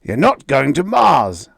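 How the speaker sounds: loud forbidding shout. A narrator's reading. Father shouting to forbid his daughter, loud. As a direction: shout her down. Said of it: "Harley shouted".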